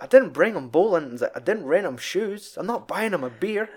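high, rising indignant Walter; imitating Walter's indignant denial; Walter correcting. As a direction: correct them point by point, building.

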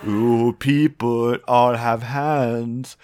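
slow, deliberate mock German accent; weird German accent. Reciting the banana hands video to friends, slow German accent. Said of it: "this kid saying this weird German accent".

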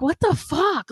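high, incredulous outburst at oneself; mock-exasperated, pitch jumping up; retold exasperation. Retold exasperation at own crying.

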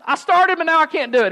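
high, loud panicked outburst; freaking out. Acted. Peter sinking on the water, freaking out. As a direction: yelp it in hammed-up panic. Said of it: "He freaks out".

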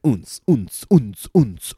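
rhythmic mock club bass; club beat imitation. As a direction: mimic a thumping bass beat.

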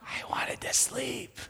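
whispered and slow; hushed, wistful whisper. Retold or imitated. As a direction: breathe it like a tired plea. Whining about lost sleep.

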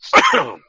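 sudden loud choking cough; coughing fit. Real, not acted. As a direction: cough hard, choking on a drink. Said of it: "I'm, like, choking here".

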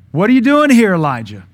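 loud, high outburst falling away at the name; exasperated shout. Acted. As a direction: holler the question at someone who won't obey. Acting God's repeated question to Elijah.

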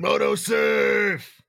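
long drawn-out rallying cry; felt catchphrase shout. Shown as a demonstration. Co-host showing the reader the catchphrase, drawn-out shout. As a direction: holler it slowly, really feeling it. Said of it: "Feel it, man".

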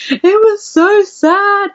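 slow, high, whimpering mock sob. Retelling to a friend how she cried after the film, slow high whimper. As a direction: wail it out like a tearful kid. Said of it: "I was like".

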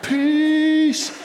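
high, drawn-out sarcastic "Peace"; mock sarcastic peace. Pastor imitating his annoyed self in the truck, sarcastic. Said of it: "It's like".

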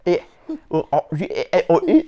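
high, loud Cockney squawks; mock Cockney accent. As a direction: stammer in hammed-up Cockney.

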